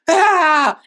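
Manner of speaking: loud, high mock cry of pain; reenacted yelp. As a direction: yelp as if jabbed, playing it up. Reenacts reaction to rod shoved in.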